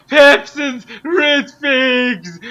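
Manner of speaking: ordinary delivery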